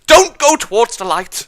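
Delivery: high, very loud yell; full-volume, high-pitched shout; screamed warning. Pretend. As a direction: scream it to stop someone.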